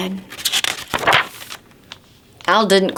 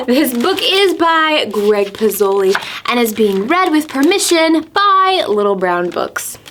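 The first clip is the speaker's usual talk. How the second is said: ordinary delivery, nothing marked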